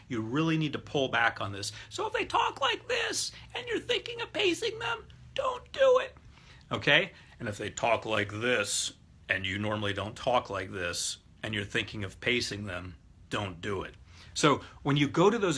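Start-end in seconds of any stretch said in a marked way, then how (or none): 1.9-6.2 absurdly high squeaky falsetto; comically high-pitched demo voice. Shown as a demonstration. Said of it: "talk with a high voice". 7.4-13.9 exaggerated low-pitched demo voice; mock deep talker. Shown as a demonstration. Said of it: "talk with a low voice".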